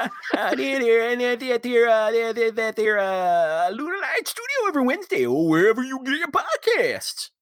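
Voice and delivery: put-on high, hollering old prospector voice. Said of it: "You sound like R".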